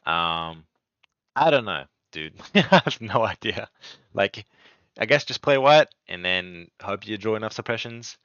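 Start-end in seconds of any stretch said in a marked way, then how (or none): 1.3-8.2 loud, higher-pitched mock helplessness with a laugh; sarcastic. Real, not acted. Player jokes about beating a too-strong deck, sarcastic. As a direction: throw up your hands sarcastically, louder. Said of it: "obviously I'm being sarcastic".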